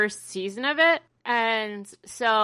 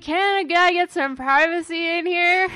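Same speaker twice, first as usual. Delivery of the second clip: high, whiny put-on complaint; annoyed Toad; 7 semitones higher than usual. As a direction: whine from the shower. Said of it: "And he's like".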